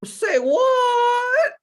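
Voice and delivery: high, loud crying-out "say what"; a real startled squeal; shocked shout. Real, not acted. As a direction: yelp it in shock. Shocked reaction to stripper news.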